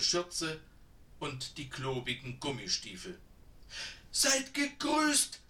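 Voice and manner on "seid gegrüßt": put-on high, slow, breathless greeting of an old woman; panting old woman. A narrator's reading. Old woman greets the hikers, high and out of breath. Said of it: "keuchte die Frau beim Näherkommen".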